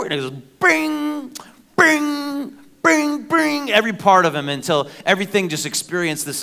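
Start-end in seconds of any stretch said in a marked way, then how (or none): 0.6-3.7 cartoon muscle pops.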